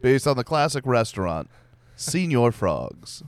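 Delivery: movie trailer voice. Pretend.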